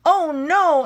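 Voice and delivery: high, squeaky pig cry; a sudden high little yelp. Acted. Little pigs answering the shark, high and alarmed. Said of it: "answered the little pigs".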